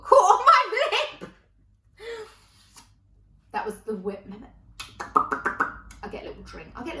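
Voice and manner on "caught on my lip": high, loud laughing disbelief; laughing outburst. Real, not acted. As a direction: blurt it out, cracking up. Real mishap, bursts out laughing.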